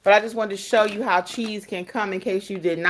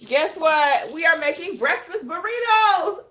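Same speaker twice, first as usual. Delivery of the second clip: excited; 8 semitones higher, 5 dB louder than usual. Real, not acted. Excited reveal of breakfast plan.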